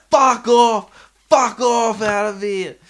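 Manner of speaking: high loud yelled brush-off; drunk shouting. Retold or imitated. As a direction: bellow him away. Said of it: "And he's just like".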